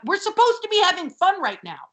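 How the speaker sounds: high, mock-frantic yelling; mock hysterical outburst. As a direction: yell it high, insisting on fun. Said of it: "if you yell at them".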